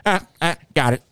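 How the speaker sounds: lightly voiced relieved find; mild, sheepish relief; relieved Corey. Corey finding his bag, lightly voiced sheepish relief. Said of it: "he's like".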